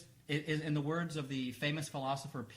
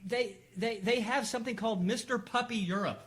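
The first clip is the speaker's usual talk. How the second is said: incredulous; 7 semitones higher, 4 dB louder, slower than usual. Real, not acted. Incredulous rant about puppy contest.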